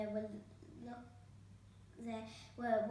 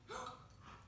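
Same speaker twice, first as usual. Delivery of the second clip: gasp; 10 dB quieter than usual. A narrator's reading. Herb gasps.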